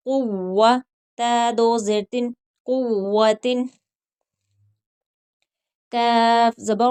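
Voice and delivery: slow, drawn-out letter-by-letter recitation; slow tajweed drill. Shown as a demonstration. Teacher drilling students letter by letter, slow recitation. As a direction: spell and join each syllable like a Quran teacher.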